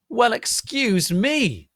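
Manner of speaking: slow, high, loud indignant shout; angry shout. A narrator's reading. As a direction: drag it out in outrage. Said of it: "they both shouted angrily".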